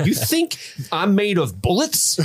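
slow, high-pitched mock outrage; incredulous henchman. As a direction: stretch it out in exaggerated disbelief. Acting out Carl's baffled reaction.

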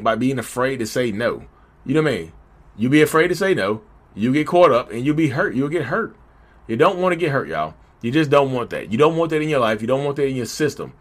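ordinary delivery

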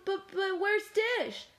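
high, stammering question; little character searching for a friend; worried Spoon. A narrator's reading.

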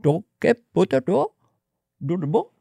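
put-on lyrical Indian-accented gibberish; singsong nonsense in a mock Indian accent. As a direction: babble easygoing, lilting mock Indian syllables. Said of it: "it's lyrical and very copacetic".

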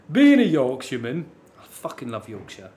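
loud mock Yorkshireman; Yorkshire accent. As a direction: put on a blunt Yorkshire voice. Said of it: "slightly weird end for Yorkshireman there".

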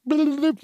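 high quick bloop noise; cartoon pop sound. Describing what the AI makes, high bloop sound. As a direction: pop it out like magic appearing. Website appearing by magic.

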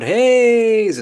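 a high, loud, hammed-up party greeting; big loud hey. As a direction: yell hey across the bar like greeting old friends. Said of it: "with some big loud".

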